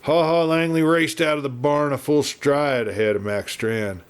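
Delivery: usual speaking voice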